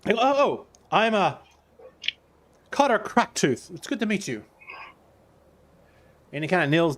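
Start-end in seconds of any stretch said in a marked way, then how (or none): 0.1-4.4 a little higher, eager to introduce himself; friendly rustic trader saying his name; eager Cotter. Acted.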